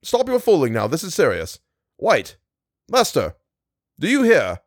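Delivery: high-pitched, insistent calling; urgent cry. A narrator's reading. Meagle demands his sleeping friends answer, crying out. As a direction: cry out sharply at each sleeper. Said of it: "he cried".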